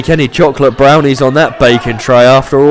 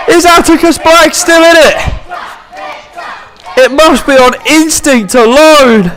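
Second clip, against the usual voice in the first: wrestling commentator; 4 dB louder than usual. Acted. Live wrestling play-by-play.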